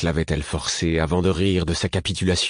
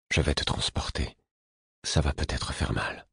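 softer, gentle warning to a sleeper; soft-spoken; 2 semitones lower, 5 dB quieter than usual. A narrator's reading. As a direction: ease him into it quietly. Gently talking to sleeping fool.

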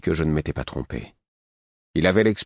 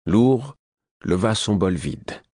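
plain reading voice

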